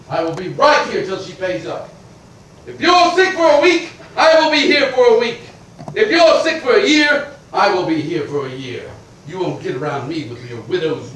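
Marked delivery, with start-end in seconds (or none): 2.7-8.9 loud, high vow to wait; defiant declaration. Acted. Creditor vowing to wait out the widow, loud and defiant. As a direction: hammer it home at her door.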